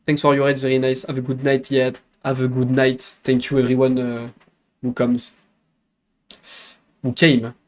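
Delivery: English good night in a French accent; French-accented thanks, slightly hesitant. Real, not acted. Streamer thanking raiders for their visit, French accent. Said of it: "Le accent is cute".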